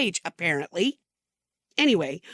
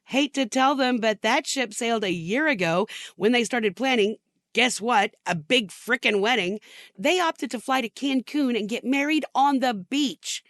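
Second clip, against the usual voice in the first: ordinary delivery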